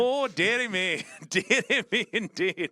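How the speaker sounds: mock dearie me. Caster laughs at the silly accents, old-gent style. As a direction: giggle through it like an old gent.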